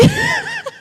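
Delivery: high shrieking burst of laughter; real helpless laugh, squealed. Real, not acted. She bursts out laughing over the McWedgie joke, shrieking.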